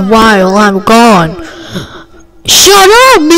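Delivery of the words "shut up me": high, crying shout; pretend angry outburst. Kid playing teacher yells at a toy student, crying shout. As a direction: snap it out like a hurt, angry kid.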